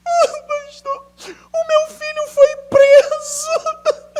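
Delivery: high, drawn-out wailing sob; mock crying mother. Pastor imitating a mother crying to him. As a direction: wail it like a weeping mother. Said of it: "Ela chegou chorando pra mim".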